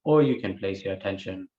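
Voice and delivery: measured meditation guidance with long pauses; guided meditation. Real, not acted. Teacher guiding listeners to watch the breath, unhurried guided meditation. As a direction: guide the breath phrase by phrase, leaving space.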